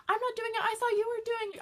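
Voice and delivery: high, loud protest that she isn't moving it; panicky denial. Retold or imitated. Lily denying to her friend over the moving board, high and panicky. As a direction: squeal the denial.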